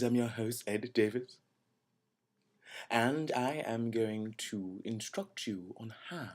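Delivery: ordinary talk, nothing marked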